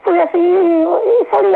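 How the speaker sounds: fighting back real tears as she speaks; tearful, remembering getting by after emigrating; choked up. Real, not acted. Emigrant recalling hard start abroad.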